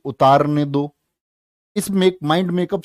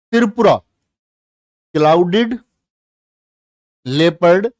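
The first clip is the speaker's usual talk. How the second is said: calls the state name out loud and high; loud announcement; 4 semitones higher, 7 dB louder than usual. Shown as a demonstration. Teacher announces the next state to students loudly. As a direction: announce the heading to the class. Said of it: "ठीक है".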